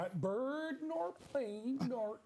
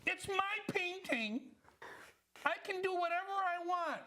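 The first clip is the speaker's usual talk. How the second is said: put-on bratty kid; 7 semitones higher than usual. Child defying the teacher.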